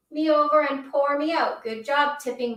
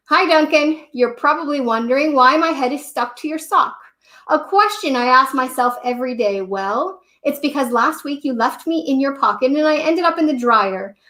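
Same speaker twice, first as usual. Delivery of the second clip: chatty, louder Turquoise Crayon with a laugh; cheeky crayon letter; 8 dB louder than usual. Acted. Storyteller reads Turquoise Crayon's silly postcard to Duncan, louder. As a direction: tell it like a cheerful stuck-on friend.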